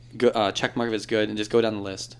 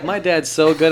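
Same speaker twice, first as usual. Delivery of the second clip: higher, louder mock bragging about dad; mock gushing kids; 5 semitones higher, 7 dB louder, slower than usual. Teasing friends, higher louder mock gushing. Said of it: "You guys are all like".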